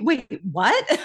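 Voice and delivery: taken aback, high, breaking into a laugh; acted bafflement. Attorney reacting to the New Jersey question, mock bafflement with a laugh. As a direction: act out a baffled double take.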